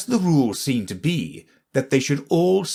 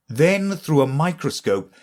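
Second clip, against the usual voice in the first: usual speaking voice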